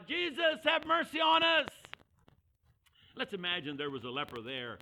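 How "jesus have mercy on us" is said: high, loud desperate plea; hollering out. Retold or imitated. Leper calling to Jesus as the crowd nears, hollering. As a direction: shout it out over the crowd. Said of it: "he starts hollering out".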